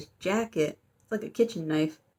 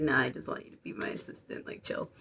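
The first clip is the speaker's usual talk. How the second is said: low, softer mock-chill brush-off; casual backpedal; 6 semitones lower, 6 dB quieter than usual. Ghost boy teasing her plays it down, mock-casual. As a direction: drop it down and play it cool.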